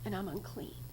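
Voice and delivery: hushed, fearful admission; trembling confession. Acted. Woman confessing to Jesus, hushed and afraid. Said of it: "in trembling".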